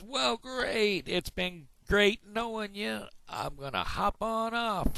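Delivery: very high, drawn-out cartoon frog; big-mouth frog. Big-mouth frog says goodbye to duck, high and stretched. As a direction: send the frog's voice way up. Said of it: "I'm the big mouth frog".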